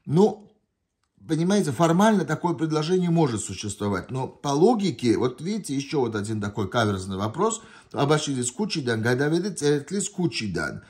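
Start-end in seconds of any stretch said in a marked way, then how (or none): none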